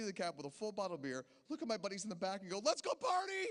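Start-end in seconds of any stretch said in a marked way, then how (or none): 2.6-3.5 much higher, hyped-up shout; party whoop. Retold or imitated. Cheering to his buddies in the cab, excited shout.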